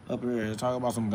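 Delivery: ordinary talk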